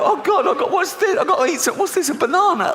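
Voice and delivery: high, frantic and starving; desperate starving shopper. Retold or imitated. Starving shopper hunting for food, high frantic whine. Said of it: "They go".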